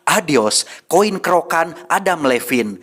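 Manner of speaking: brisk, bright review narration; recap narration. Acted. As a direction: announce it like a video host.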